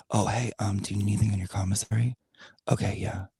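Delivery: sneaky whispered offer, hushed and quick; conspiratorial mock whisper. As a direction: whisper it like a schemer slipping in. Mock sneaky jail visitor.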